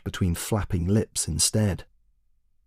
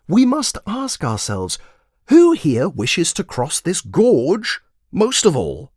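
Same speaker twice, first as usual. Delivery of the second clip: put-on high, emphatic, slow old wizard; lecturing wizard; 9 semitones higher, 3 dB louder, slower than usual. Wizard lecturing the commander on magic, high and grand. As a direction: proclaim it like a grand sage.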